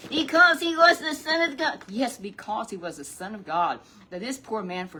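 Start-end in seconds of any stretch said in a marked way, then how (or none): 0.1-1.8 high, loud puppet declaring it. Acted. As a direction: let the puppet shout the answer.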